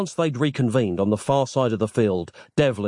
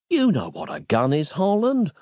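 mildly ingratiating, rising in pitch; a touch of fawning; obsequious; 7 semitones higher than usual. A narrator's reading. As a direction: placate a tyrant carefully. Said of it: "said in an obsequious tone".

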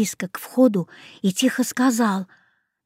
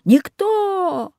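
much higher, bellowing cyclops; roaring giant; 11 semitones higher, much slower than usual. A narrator's reading. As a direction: roar it in pain. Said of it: "взревел гигант".

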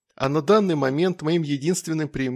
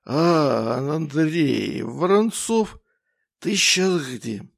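put-on getting drunker; much slower than usual. A narrator's reading. Drunk Ignat on phone.

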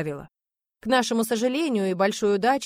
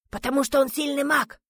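raised-pitch, strained reply; hushed answer; 6 semitones higher than usual. A narrator's reading. Hidden teammate explaining why the man is needed, high strained hush. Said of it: "Разговаривали мы все шёпотом".

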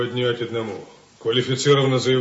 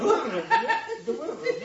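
playful laughing demand; 13 semitones higher than usual. Acted. Tickling friend for money.

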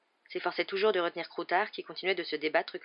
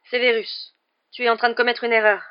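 louder, higher, rushing to correct him; urgent, hasty protest; 6 semitones higher, 11 dB louder than usual. A narrator's reading. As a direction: cut in urgently with a raised voice. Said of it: "dit précipitamment Lupin". (protestation précipitée)

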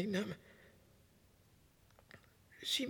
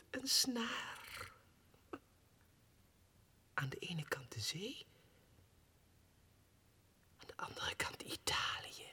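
soft, lower, floating whisper; dreamy whisper; 5 semitones lower, 6 dB quieter than usual. Acted. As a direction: drift away in a whispered vision. Dreamy flight vision. (dromerig gefluisterd)

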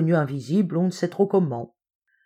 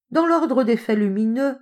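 raised pitch, a touch carried away; somewhat exalted; 7 semitones higher than usual. A narrator's reading. Hopkins lecturing his defeated friend, somewhat exalted. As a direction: press on, fired up by your argument. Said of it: "continuait Hopkins quelque peu exalté".

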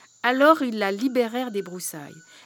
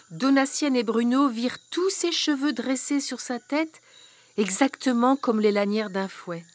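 ordinary delivery

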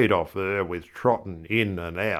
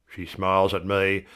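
ordinary delivery

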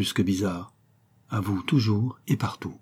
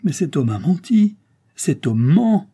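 higher, louder indignant cry; raised, emphatic exclamation; exclaiming; 8 semitones higher, 6 dB louder, slower than usual. A narrator's reading. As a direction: cry out in protest at a lie. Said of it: "s'écria Concepcion".

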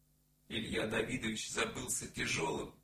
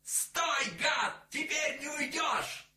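high, loud piercing yell; piercing shout; 5 dB louder, slower than usual. A narrator's reading. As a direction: scream it out, catching him. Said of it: "с пронзительным криком". (пронзительный крик)

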